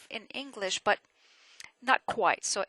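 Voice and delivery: ordinary delivery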